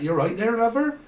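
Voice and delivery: put-on a light Cornish accent, lilting up at the end; a hint of Cornish, rising on lover; playful Cornish accent, lightly done. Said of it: "and speak a little bit like that".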